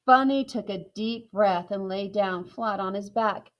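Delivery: usual speaking voice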